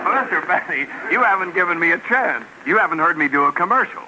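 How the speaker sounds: ordinary delivery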